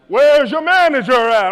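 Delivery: loud, high, slow demand; hammed-up outrage; irate customer. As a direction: drag out the shouted question. Angry customer demands manager.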